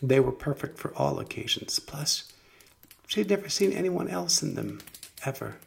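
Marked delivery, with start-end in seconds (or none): none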